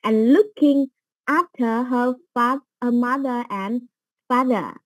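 very slow, word by word, like dictation for students; slow dictation reading. Shown as a demonstration. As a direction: spell it out slowly so learners catch each word. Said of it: "Chỗ này bị thiếu". (đọc rất chậm từng chữ như đọc chính tả)

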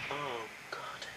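a breathless whisper under pressure; barely voiced, hoping nobody hears; hushed nervous whisper; whispered oh God. Tense moment waiting for hamster.

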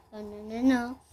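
ordinary talk

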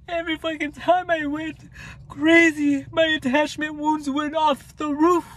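the frustration spikes up high as it spills out; real exasperation, pitched well up; worked up. Real, not acted. Recalling falling for the trap.

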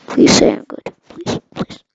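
loud, breathy begging; loud pleading. Real, not acted. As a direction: plead with the viewers, loud and desperate. Begging viewers for praise.

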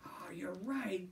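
panting, unvoiced whisper; out of breath, whispered; breathless whisper.

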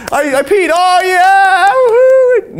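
put-on very high, loud baby crowing over peeing; proud baby. Toddler celebrating peeing, high loud whoop. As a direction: cheer for yourself like a proud toddler. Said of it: "Yeah, just".

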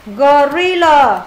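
"Gorilla" drawn out slowly; slow model word. Shown as a demonstration. Teacher slowly models the word for students. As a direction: stretch out "Gorilla" for the class. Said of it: "là âm ờ luôn".

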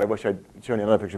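ordinary delivery

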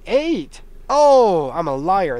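high, loud startled discovery; surprised. Real, not acted. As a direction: jump up in pitch on finding it. Recounting seats, finds an eighth.

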